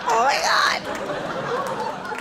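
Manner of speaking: can't hold back delight at the fall; high squealing laugh; gleeful gossip. Retold or imitated. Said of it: "turn around and go".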